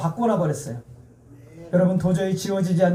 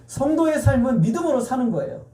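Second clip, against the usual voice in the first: ordinary talk, nothing marked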